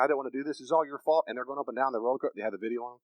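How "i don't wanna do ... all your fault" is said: ordinary delivery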